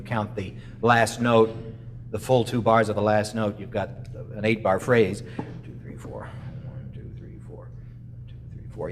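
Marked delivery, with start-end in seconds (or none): 5.6-8.9 whispered counting of beats. Real, not acted. Teacher whispers the count while the student plays. Counting the bars under playing.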